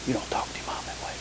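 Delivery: breathy whisper, soft and quick. Shown as a demonstration. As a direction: hush the scolding into a whisper. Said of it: "much more done by whispering".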